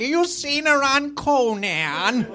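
high, slow, put-on grandma voice; grandmother. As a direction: draw it out like a grandmother. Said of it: "like a grandmother".

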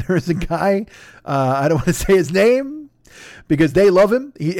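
ordinary delivery

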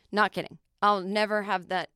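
normal talking voice

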